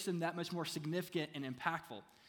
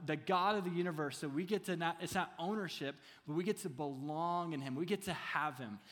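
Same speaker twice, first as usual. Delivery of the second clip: ordinary delivery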